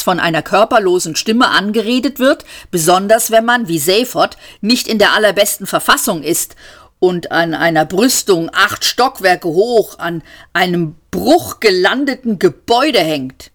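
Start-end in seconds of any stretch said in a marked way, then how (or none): none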